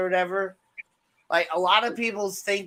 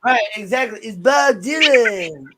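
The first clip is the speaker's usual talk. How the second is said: jut the jaw and croak like [name]; [name] voice; 4 semitones higher, 8 dB louder than usual. Retold or imitated. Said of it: "push your jaw out a little bit".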